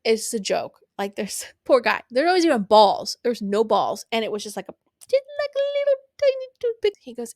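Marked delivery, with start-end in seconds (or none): 4.7-6.9 squeaky tiny voice. Real, not acted. Mocking the tiny size.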